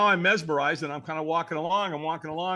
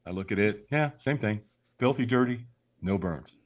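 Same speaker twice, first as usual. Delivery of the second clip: ordinary delivery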